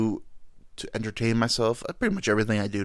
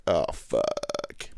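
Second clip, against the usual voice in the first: dread; 9 semitones lower, 5 dB quieter than usual. Pretend. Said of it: "And I'm like".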